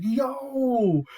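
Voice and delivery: high, loud hype shout; excited yell. Retold or imitated. Fan hyping the scene, yelling high and loud. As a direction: yell it at the screen. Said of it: "I was like".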